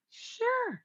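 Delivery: high, crisp professional "Sure"; prim office voice showing she can be professional. Shown as a demonstration. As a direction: go polished and businesslike. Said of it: "hit it perfectly".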